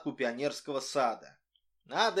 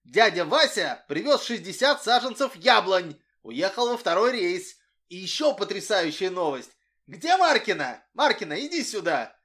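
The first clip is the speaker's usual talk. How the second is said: louder, higher rush of news; blurting out; 4 semitones higher, 7 dB louder than usual. A narrator's reading. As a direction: blurt it all out at once. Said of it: "выпалил".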